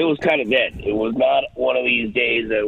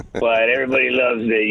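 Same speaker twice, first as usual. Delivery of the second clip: ordinary delivery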